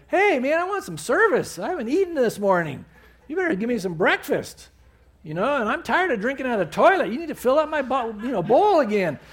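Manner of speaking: high, pushy, fast comic dog voice; demanding dog. Pretend. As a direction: boss your owner around as the dog. Joking dog demands breakfast.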